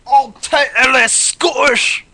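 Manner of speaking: put-on loud, slow, high Scottish accent. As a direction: roar it in a hammed-up Scottish accent. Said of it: "They're demanding Scottish".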